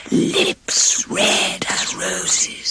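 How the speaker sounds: slow eerie whisper; magic mirror whispering the answer. A narrator's reading. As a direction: breathe it out like a ghost in glass. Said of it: "said the voice in the mirror".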